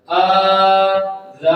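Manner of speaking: آ and ذا each held long, said separately; lengthened vowels. Shown as a demonstration. Teacher breaks the word down, long آ and long ذا. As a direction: stretch آ, pause, then stretch ذا. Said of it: "تھوڑا سا لمبا کر کے پڑھنا".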